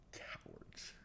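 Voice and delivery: whispered insult. Real, not acted. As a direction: breathe the word out.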